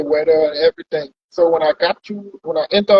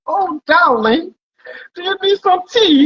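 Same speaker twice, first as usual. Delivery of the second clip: put-on high, slow, genteel British lady accent; 12 semitones higher, 3 dB louder, slower than usual. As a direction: coo it like a posh British lady offering tea. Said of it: "she went with a little British accent".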